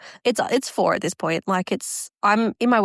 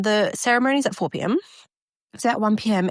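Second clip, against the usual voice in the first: ordinary delivery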